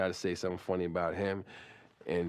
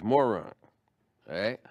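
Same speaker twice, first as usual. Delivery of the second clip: usual speaking voice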